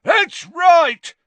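high, very loud roar. Acted. Father confirming his name to the official, roaring. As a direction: roar it back triumphantly. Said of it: "roared Gaunt".

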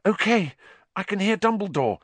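high-pitched, keyed-up report; tense. A narrator's reading. Hermione listens near the hospital wing, tense. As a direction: hold the nerves tight while reporting. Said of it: "said Hermione tensely".

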